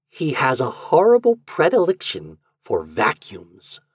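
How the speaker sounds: plain reading voice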